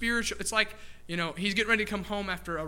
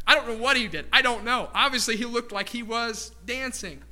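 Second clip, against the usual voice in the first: excited; 4 semitones higher, 5 dB louder than usual. Real, not acted. Excited preaching outburst.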